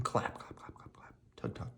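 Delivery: soft whispered clap clap; whispered sound effects. Acted. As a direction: breathe the sounds out softly.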